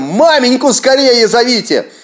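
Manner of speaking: high, loud urgent order; shout. A narrator's reading. Akim shouting to his sons to fetch mother, urgent shout. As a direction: yell for help to the boys. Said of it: "Кричит сыновьям".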